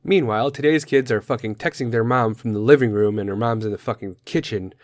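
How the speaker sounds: usual speaking voice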